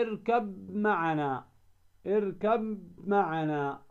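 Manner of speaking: slow recited اركب معنا with the ب merged into the م as a doubled مّ; Quranic recitation. Shown as a demonstration. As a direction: chant اركب معنا slowly, merging ب into م. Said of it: "مثال ذلك قوله تعالى".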